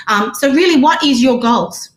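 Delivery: normal talking voice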